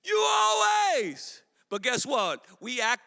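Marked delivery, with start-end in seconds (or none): 0.0-1.4 put-on slow whining teenager protest, louder; whiny teenager. Teenager to parent after a no, mock whining shout. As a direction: whine it like a teen told no. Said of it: "That's my teenager".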